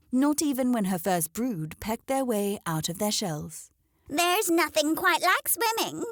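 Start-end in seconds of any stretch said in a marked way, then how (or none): 4.1-6.0 high, slow, smirking hen voice; silly smirk. A narrator's reading. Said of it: "remarked with a silly smirk".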